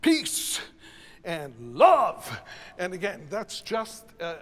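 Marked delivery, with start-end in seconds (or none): 0.0-0.6 "peace" hit high and loud; accented staccato. Shown as a demonstration. Choir director showing the same accent, loud staccato "peace". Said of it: "the same thing happens with". 1.8-2.4 "love" swelling loud on one accent; stressed accent. Shown as a demonstration. Choir director showing the same accent, loud stressed "love". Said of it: "the same thing happens with".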